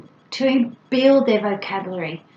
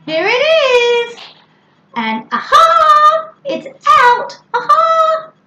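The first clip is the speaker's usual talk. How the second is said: high, loud, drawn-out aha; big rising exclamations a small child can copy; playful exclamations; 7 dB louder, slower than usual. Shown as a demonstration. Parent giving a child sounds to copy, playful exclamation. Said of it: "imitate or copy the ooh and the ha ha".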